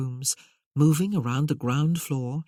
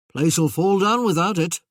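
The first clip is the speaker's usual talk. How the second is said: protesting Merlin voice; 2 semitones higher, 7 dB louder than usual. Acted.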